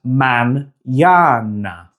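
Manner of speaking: mañana slowly split as man-YA-na, n and y apart; mispronounced mañana. Shown as a demonstration. Teacher mimicking advanced speakers' common mistake, mispronounced mañana. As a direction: say mañana with the n closing the first syllable. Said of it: "often mispronounce it as".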